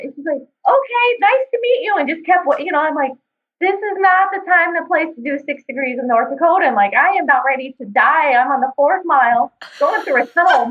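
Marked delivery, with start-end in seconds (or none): 0.4-2.0 very high mock-polite sing-out; imitating older lady. Older lady greeting an out-of-breath racer, high mock-polite. 3.6-6.7 higher-pitched mock protest; retold exasperation. Racer fed up with small talk in the mud, mock exasperated. Exasperated inner reply to the lady.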